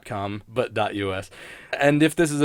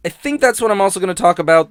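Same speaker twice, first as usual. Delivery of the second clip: ordinary delivery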